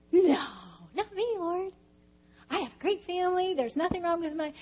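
high-pitched mock protest; acted denial. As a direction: insist to the Lord it isn't you. Acts out denial to God.

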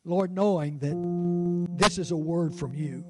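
ordinary delivery

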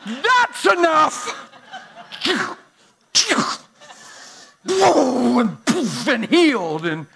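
loud, soaring preacher's cry; full-throated pulpit preaching; preaching at full force. Real, not acted. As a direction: preach it out to the whole room, building.

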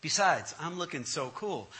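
ordinary talk, nothing marked

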